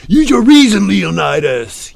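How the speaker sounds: very loud, high, hammed-up plea; movie-line impression. Streamer retrying a movie line to Leonidas, theatrical shout. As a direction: bellow it like an epic film character. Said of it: "You've seen the movie".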